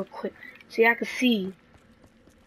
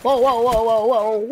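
loud startled whoa; scared yelp; 8 dB louder than usual. Real, not acted. Gamer startled by the monster, yelping. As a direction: jump back in fright. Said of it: "I got so scared".

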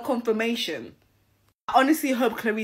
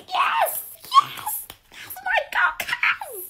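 much higher, squealing cheer for her; excited cheer; much slower than usual. Retold or imitated. Cheering on Kaz from the sofa, squealing high and proud. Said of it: "I was like".

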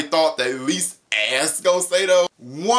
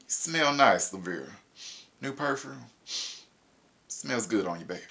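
much softer, murmured teasing compliment; hushed mock flirt; 3 semitones lower, 10 dB quieter than usual. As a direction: drop to a soft, playful aside. Mock flirting aside to villainess.

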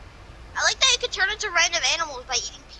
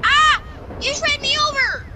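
startled yell; 11 semitones higher, 6 dB louder than usual. Real, not acted. Run over by a car.

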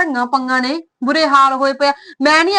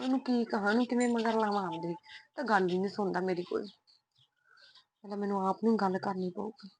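much softer, low murmur to herself; thinking aloud; 6 semitones lower, 17 dB quieter than usual. Acted. Wife alone, planning how to talk to husband, murmured very softly. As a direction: ponder quietly how to win him over.

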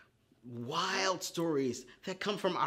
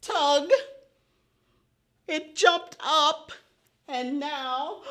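much higher and louder, building fun; playful excitement; 12 semitones higher, 7 dB louder, slower than usual. Acted. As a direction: play up the surprise for the kids. Acting out playful bug rhyme.

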